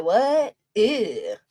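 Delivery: ordinary talk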